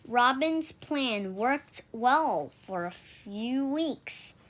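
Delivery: ordinary delivery, nothing marked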